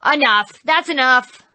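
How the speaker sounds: loud angry yell; tantrum shout. Acted. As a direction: throw a fit. Devil's tantrum.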